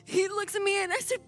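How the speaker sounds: ordinary talk, nothing marked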